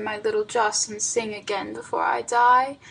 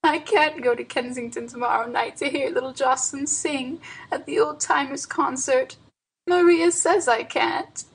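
put-on sobbing old aunt; 2 semitones higher than usual. A narrator's reading. Old aunt telling hired boy her troubles, tearful. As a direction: pour out the trouble plaintively. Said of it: "sobbed Auntie Nan".

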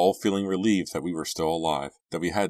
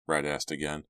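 ordinary delivery

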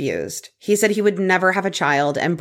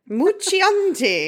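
high, slow hammed-up Italian flourish; exaggerated playful Italian accent; mock Italian accent; 13 semitones higher, much slower than usual. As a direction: sing out the name like a cartoon Italian. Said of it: "the accent you gave it".

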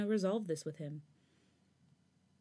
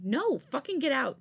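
loud roar, higher; 7 semitones higher, 5 dB louder, slower than usual. A narrator's reading. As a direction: roar him out of the room. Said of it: "Changbin roared".

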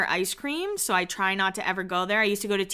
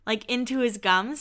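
ordinary talk

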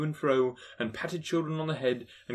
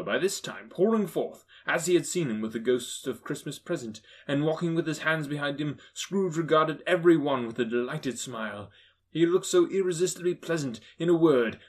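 plain reading voice

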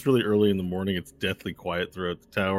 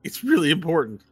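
high-pitched, urgent apologetic figure; anxious Walnut; 12 semitones higher, 6 dB louder than usual. Acted. Waking a sleeper with important news, high and apologetic. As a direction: plead in a high voice.

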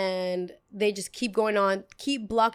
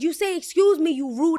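higher, louder, scolding; indignant scolding; 7 semitones higher, 4 dB louder than usual. Retold or imitated. Girl scolds him angrily.

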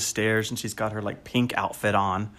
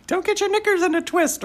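much higher, prim British scolding; host teasing co-host with a mock British voice, much higher. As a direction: toss it off like a fussy Brit. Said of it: "I can definitely picture you saying".

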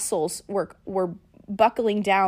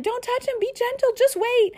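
Steve. Retold or imitated. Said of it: "Steve was like".

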